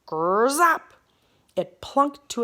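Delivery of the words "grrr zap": loud buzzing growl of an arrow; hornet arrow buzz. A narrator's reading. Arrow tearing toward Bartholomew, buzzing growl. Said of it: "Like a mad giant hornet".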